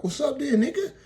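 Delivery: high, loud mock challenge; a mugger squares up, high and loud; aggressive challenge. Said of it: "He was like".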